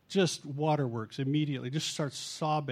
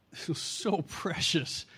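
laughing whisper; slower than usual. Real, not acted. Fond memory of friend's request.

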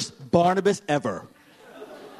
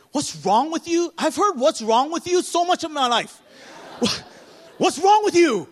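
mock angry wife; 12 semitones higher, 4 dB louder than usual. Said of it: "When she goes off on me".

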